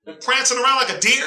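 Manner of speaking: normal talking voice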